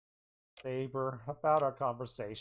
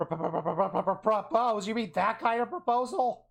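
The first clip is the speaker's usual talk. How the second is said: high, loud flustered stammering; flustered outburst; 7 semitones higher, 5 dB louder than usual. Acted. Rin reacting to Saber's old proposal, loud flustered stammer. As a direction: sputter in loud disbelief.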